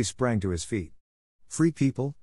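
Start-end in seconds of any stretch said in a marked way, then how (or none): 1.5-2.2 calling out high to the pack; urgent call, pitched high; cry out. A narrator's reading. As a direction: raise the voice to rally the wolves. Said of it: "he cried".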